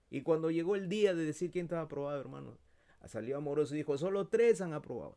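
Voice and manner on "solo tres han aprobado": loud, high proclamation; announcing professor. Retold or imitated. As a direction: announce the result to the class. Profesor anuncia resultados. (profesor anunciando en voz alta)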